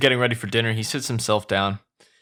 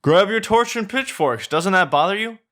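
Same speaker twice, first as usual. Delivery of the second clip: "Grab your torch and pitchforks" redone much higher and louder; corrected take; 10 semitones higher, 4 dB louder than usual. Shown as a demonstration. Reader redoes Shrek's line in a higher voice. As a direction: snap it again, higher, now in character. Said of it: "oh, sorry".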